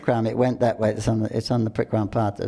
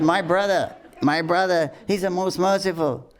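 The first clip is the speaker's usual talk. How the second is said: high, fervent declaration; indignant deity; 7 semitones higher than usual. Retold or imitated. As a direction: proclaim it with righteous fervor. Deity defends brother angrily.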